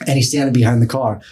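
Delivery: ordinary talk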